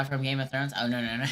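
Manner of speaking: ordinary talk, nothing marked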